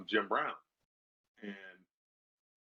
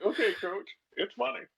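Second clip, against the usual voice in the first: higher, lightly mocked eager agreement; mock eager players; 10 semitones higher than usual. Player imitating the team humoring their coach, mock higher.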